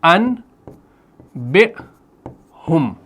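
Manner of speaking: انبئہم broken into an... bi... hum with long gaps; very slow, separated syllables of انبئہم. Shown as a demonstration. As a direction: spell it out slowly, piece by piece. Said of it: "میں کیا پڑھ رہا ہوں؟".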